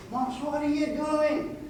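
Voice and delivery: high-pitched attempt at a squeak; squeaky voice. Father's huge friend questioning the farmer, imitated squeaky voice. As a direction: imitate a big man's squeaky voice. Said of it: "And the squeaky voice, he says".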